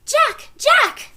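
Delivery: high loud shouts; shouting. A narrator's reading. Annie shouting for her brother from the woods, loud shout. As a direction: shout his name from far off. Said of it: "Annie shouted".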